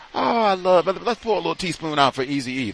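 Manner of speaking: high put-on gush, easing down; mock gushing fan. Fan toasting [name], high mock gushing. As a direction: gush over it in a mocking high voice.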